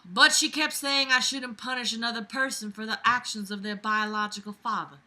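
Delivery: put-on Southern belle accent; [name], Southern belle. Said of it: "my Southern Belle accent".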